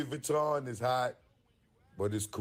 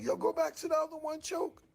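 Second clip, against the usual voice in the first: high and fast; friend's voice. Retold or imitated. Friend telling him to go back to the other sweater, high and fast. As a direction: rush it up high. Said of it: "He's like".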